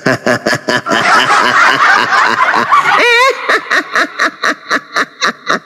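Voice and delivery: long rising witch cackle; Mak Lampir evil cackle. Acted. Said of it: "Boleh dong, saya ketawa jahat". (tawa jahat melengking ala nenek sihir)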